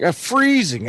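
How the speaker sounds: high, whiny imitation of a cold friend; mock freezing complaint. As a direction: play up a shivering buddy's complaint.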